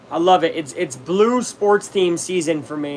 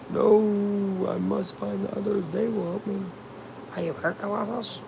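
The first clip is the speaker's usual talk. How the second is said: soft, put-on character voice; quiet mock-dramatic concern; worried hero figure; 3 semitones higher, 8 dB quieter than usual. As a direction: play an earnest hero, hushed. Puts on a story character's voice.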